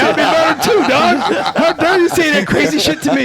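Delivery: high, loud incredulous rant with laughter; laughing fake indignation at the judge; mock outrage. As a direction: act offended at the judge, hamming it up.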